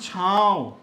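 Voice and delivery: छाँव loud with long nasal आ; छाँव, held and nasal; nasal vowel. Shown as a demonstration. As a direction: send the vowel of छाँव into the nose. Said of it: "आ रहा है ना नाक पे".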